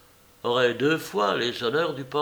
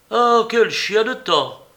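loud, high outburst; exclaiming; 10 semitones higher, 9 dB louder, slower than usual. A narrator's reading. Young man rushing in out of bad weather, loud exclamation. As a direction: draw out the curse loudly and high.

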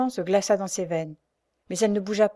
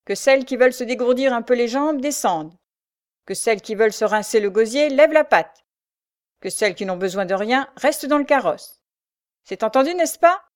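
loud, raised parade-ground announcement; announcing sergeant; 4 semitones higher, 6 dB louder than usual. A narrator's reading. Sergeant to the women at the inn, loud announcement. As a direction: address the whole troop, ending high. Sergeant addresses all the prisoners.

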